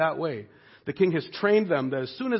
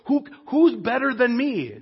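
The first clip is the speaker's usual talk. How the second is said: higher, puffed-up boast; proud boast; 7 semitones higher, 4 dB louder than usual. Retold or imitated. Preacher voicing the king's pride, higher and boastful. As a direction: swagger like a vain king. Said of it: "In your pride".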